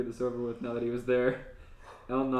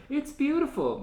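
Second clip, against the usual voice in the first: much higher, breathless wonder; gasping in awe; 14 semitones higher, 5 dB louder than usual. Acted. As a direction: gasp it out, amazed. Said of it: "Misa gasped in awe".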